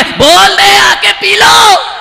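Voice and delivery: loud, slow, high snapping reply; angry mother retort. Acted. Angry wife answering husband through son, shouted. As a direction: throw it back at him.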